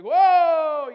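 a loud, high burst of "whoa"; joyful shout. Retold or imitated. Pastor overcome with joy in church, a loud whoa. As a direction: let it burst out of you as the joy hits. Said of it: "when it hits him, man, it's like".

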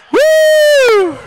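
very high, sustained "woo"; long high whoop. Real, not acted. Preacher stirring up the church, long piercing whoop.

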